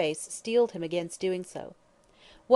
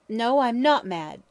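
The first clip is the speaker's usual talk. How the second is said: louder and higher, slowed, desperate protest; wild cry; 4 semitones higher, 7 dB louder, slower than usual. Acted. Brian denying madness to the lawyer, wild cry. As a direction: cry it out wildly. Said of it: "cried Fitzgerald wildly".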